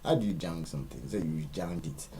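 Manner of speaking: ordinary talk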